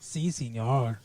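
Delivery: slow, soft Mexican accent; hammed-up Mexican accent. Shown as a demonstration. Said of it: "I can do a Mexican accent".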